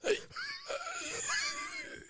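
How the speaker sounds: strained high squeak of a voice; lost voice croak. Shown as a demonstration. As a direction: croak like your voice is gone. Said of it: "my voice was completely gone. I'm talking like".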